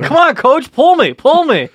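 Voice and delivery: high, loud pleading goalie voice; begging goalie. Pretend. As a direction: whine it up high, begging the coach. Voicing goalie begging coach.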